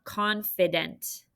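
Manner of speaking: slow careful read. Shown as a demonstration. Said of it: "our word of the day".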